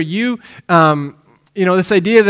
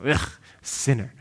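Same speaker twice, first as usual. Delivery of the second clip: dismissive scoff; 5 semitones lower, 8 dB quieter than usual. Retold or imitated. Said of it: "kind of a dismissal".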